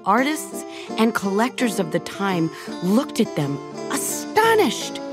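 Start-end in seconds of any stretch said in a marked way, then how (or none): none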